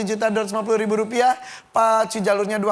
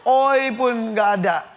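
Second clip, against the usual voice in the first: normal talking voice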